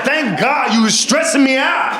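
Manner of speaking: put-on high, slow, relieved old auntie shouting; high relieved auntie with a laugh; relieved old lady. Voicing relieved old lady doctor.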